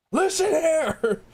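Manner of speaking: high, slow mock angry yell; mock scream. Imitating a friend's angry scream, slow high mock yell. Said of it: "just you doing your scream".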